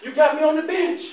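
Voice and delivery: high, loud, laughing protest; indignant complaint. Retold or imitated. A benched player protesting to the coaches, with laughing indignation. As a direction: complain like a benched star, half joking. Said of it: "I'm like".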